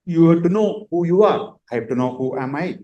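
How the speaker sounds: ordinary talk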